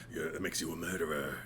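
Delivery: low, quiet movie-trailer voice; hushed, deep-voiced film character impression; Keith David. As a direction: drop deep and soft, quickly, like a trailer voiceover. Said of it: "Keith David is the most fun to do impressions of".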